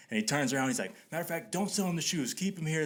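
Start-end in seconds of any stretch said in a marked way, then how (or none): 1.1-2.9 high, insistent retold orders; imitated bossy homeowner. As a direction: give orders as the irked homeowner. Acting homeowner giving orders.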